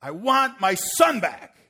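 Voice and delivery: loud, high, drawn-out anguished cry; retold tearful wail at full volume; hollering through tears. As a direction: holler it slowly, acting out a weeping father. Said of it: "he hollers out through tears".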